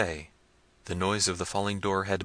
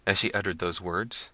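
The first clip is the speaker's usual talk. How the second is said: ordinary delivery, nothing marked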